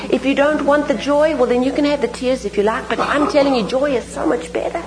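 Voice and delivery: normal talking voice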